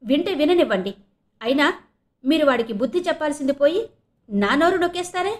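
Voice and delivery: loud, high, defiant retort; angry shouting. A narrator's reading. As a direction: snap back at husband, climbing to an indignant question. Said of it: "ఇంకా గట్టిగా అరిచింది". (కోపంగా గొంతు పెంచి)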